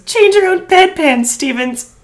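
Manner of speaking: yelling. Retold or imitated. Quoting Olivia's line.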